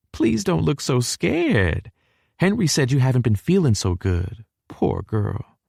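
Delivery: high, fussing Texan mother; high, drawn-out motherly sympathy; sympathetic southern mama. A narrator's reading. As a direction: coo over the guest in a high southern voice. Mama comforts nervous guest.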